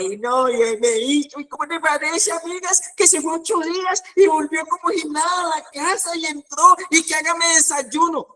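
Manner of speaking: high, half-shouted mock lament; wailing complaint. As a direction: play the jilted friend venting through mock tears. Crying friend complains of husband.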